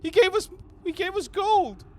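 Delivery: frightened human prisoner pleading; begging prisoner. Acted. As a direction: beg for his life in a squeaky voice. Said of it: "He's like".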